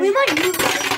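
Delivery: loud stress on might; loud, emphatic. Real, not acted. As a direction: call it out loudly. Repeats the line loudly.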